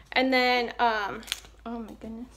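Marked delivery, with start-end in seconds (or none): none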